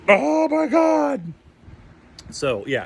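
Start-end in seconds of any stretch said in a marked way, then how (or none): 0.1-1.2 much higher, slow, breathless "Oh my God"; starstruck awe. Retold or imitated. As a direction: gasp it out, totally starstruck. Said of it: "completely starstruck".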